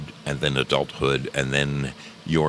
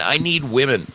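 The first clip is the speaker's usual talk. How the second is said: pleading, pitched up; begging; 7 semitones higher than usual. Real, not acted. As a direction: beg for them to come. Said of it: "I'm begging for contestants".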